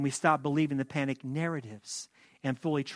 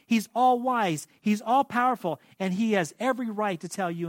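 high, loud, insistent proclamation; full-force preaching; 6 semitones higher, 5 dB louder than usual. Acted. Pastor proclaiming God's holiness to the church, fervent preaching. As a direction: preach it out with fire. Preaching about who the Lord is.

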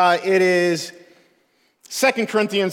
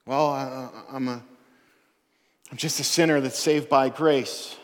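soft, halting mock self-doubt; a hint of resigned uncertainty, spoken low-key; lightly acted doubting believer; 4 semitones lower, 5 dB quieter than usual. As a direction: play a hesitant believer, giving up quietly. Preacher acts a resigned, doubting believer.

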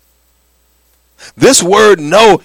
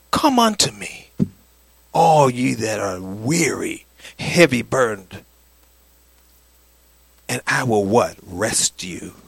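soft tender; 10 semitones lower, 9 dB quieter, slower than usual. Acted. Soft scripture quote.

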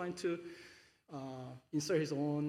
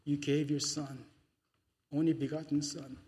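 prayer. Acted. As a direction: pray gently to the Father. Pastor leads closing prayer.

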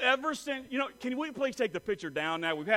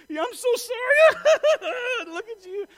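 high, loud apology breaking into laughter; squealing mock-sorry through laughs; imitated helpless laughter; 11 dB louder, slower than usual. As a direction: imitate a wife laughing too hard to apologize. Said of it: "she's just laughing at me".

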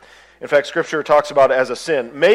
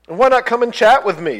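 high, bright, cheerful grasshopper voice; carefree chirpy grasshopper; 10 semitones higher, 4 dB louder than usual. Acted. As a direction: sing it out like a lazy, happy insect. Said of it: "said the grasshopper".

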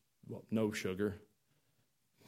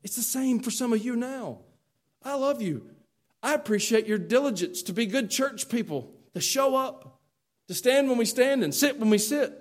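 usual speaking voice

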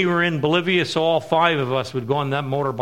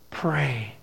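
hushed echo of the word Pray; softer second Pray after the first; soft repeat; 4 semitones lower, 7 dB quieter than usual. Acted. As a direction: let the word fall away softly.

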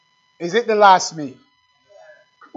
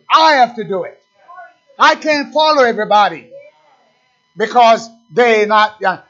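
urgent pulpit preaching, a touch louder; preaching at full force; 4 semitones higher, 5 dB louder than usual. Acted. Preacher urges each listener to open their heart, fervent. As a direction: raise it, pressing the point home.

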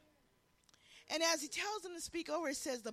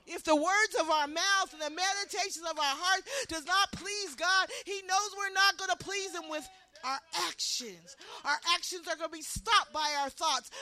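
louder, insistent preaching; preaching push; 4 semitones higher, 6 dB louder than usual. Acted. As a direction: press the congregation hard. Preaching rises again.

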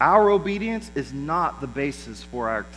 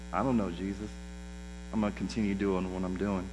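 low, much softer, offhand disowning; muted mock denial; 8 semitones lower, 10 dB quieter, faster than usual. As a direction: back away from it under your breath. Voicing believers backing away from Jesus.

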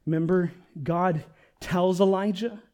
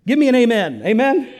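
loud, higher call for a response; rally the room with raised voice; rousing call; 7 semitones higher, 10 dB louder than usual. Real, not acted. Preacher asking the congregation for an amen, rousing.

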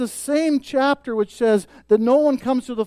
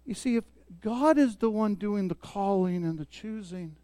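much softer, intimate and close; hushed sermon aside; 2 semitones lower, 9 dB quieter than usual. Real, not acted. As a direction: drop to a near hush to draw them in. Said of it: "intimate soft point about safety".

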